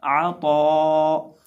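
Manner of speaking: आ तो chanted very slowly, heavy तो; drawn-out recitation. Shown as a demonstration. Teacher joins the letters for students, slow chant. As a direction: hold the vowel of तो long and full. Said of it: "तो ज़बर अलिफ तो".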